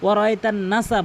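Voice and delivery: ওয়ারাআইতান নাসা unstretched, spoken; wrong short n. Shown as a demonstration. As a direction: rush past the n in নাসা. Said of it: "অধিকাংশ লোক ভুল বলে".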